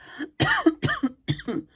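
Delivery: coughing fit; sudden choking cough. Real, not acted. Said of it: "Sorry, I'm choking".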